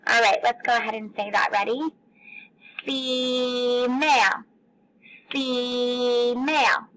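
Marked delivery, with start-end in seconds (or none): 2.8-6.9 female said very slowly with the first E held long; held-out syllables. Shown as a demonstration. Teacher holding out the E in female for kids. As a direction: stretch the E in female, feee-male. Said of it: "What were we holding out there".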